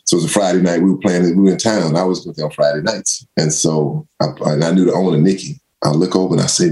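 ordinary delivery

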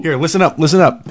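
loud, calling out to the room. Real, not acted. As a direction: call them to attention. Calling everyone to listen.

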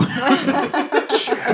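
high burst of real laughter. Real, not acted. Player laughing at the table joke, high and real.

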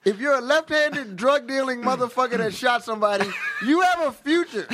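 loud, high hype pitch; mock inspirational. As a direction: sell it like a pep talk.

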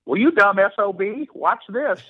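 high-pitched cocky ballplayer; high, teasing imitation of a player's retort; Larry Bird impression. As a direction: pitch it up, tossing out a playful challenge. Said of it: "try to do my Larry Bird impression".

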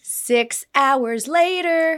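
slow, loud mock-epic storytelling; dramatic time skip. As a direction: stretch it slowly like a caption card.

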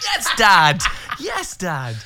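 loud, high shout of approval; triumphant cheer. Acted. Son cheers Dad winning singer over, triumphant shout. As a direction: yell it proudly, pitch way up.

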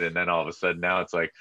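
normal talking voice

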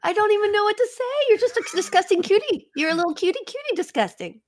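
cutesy teasing. Acted. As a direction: coo at him like a pet. Teasing Kevin affectionately.

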